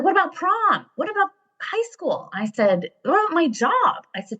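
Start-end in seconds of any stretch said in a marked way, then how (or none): 0.0-2.3 put-on very high, indignant teen protest; imitated mad daughter. Daughter mad about missing prom and school, imitated indignation. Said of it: "So she's mad". 3.0-4.1 high, protesting mock indignation; imitated mad daughter. Daughter listing reasons against leaving, imitated indignant protest. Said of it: "So she's mad".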